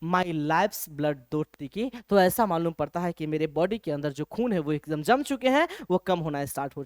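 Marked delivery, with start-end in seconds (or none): 0.0-1.9 slow poem reading. Shown as a demonstration. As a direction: stretch each word of the verse. Teacher recites poem line.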